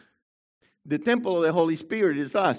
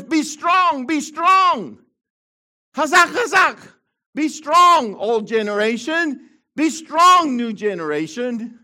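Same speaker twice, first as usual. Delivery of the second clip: high, loud, rising pulpit declaration; rally the room like a revival preacher; preaching at full force; 6 semitones higher, 8 dB louder than usual. Real, not acted. Pastor urging his congregation to be strong, loud preaching.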